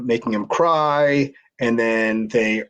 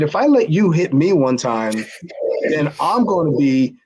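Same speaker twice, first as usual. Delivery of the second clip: higher-pitched mock indignation; retold protest; 5 semitones higher than usual. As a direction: argue back as if replaying the row. Retelling his protest at the plea.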